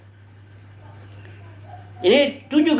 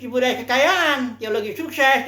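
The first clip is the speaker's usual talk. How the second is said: ordinary talk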